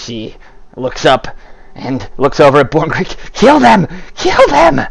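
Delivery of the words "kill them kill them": high, louder repeated order; commanding shriek. Acted. Anzi ordering Borngreek to attack, high commanding shriek.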